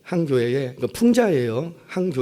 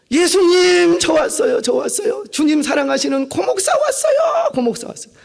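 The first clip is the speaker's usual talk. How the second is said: much higher, shouting his own arrival; boastful shout; 12 semitones higher, 4 dB louder than usual. Retold or imitated. Self-important pastor shouting to Jesus that he has come, loudly. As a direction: shout it out, demanding notice.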